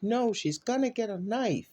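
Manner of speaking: high urgent warning call; retold frantic yell. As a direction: cry out the danger in a panic. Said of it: "I hear yelling again".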